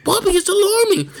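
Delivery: put-on very high, loud child's cry; panicky little boy. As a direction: imitate a child bursting in alarmed. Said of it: "He's like".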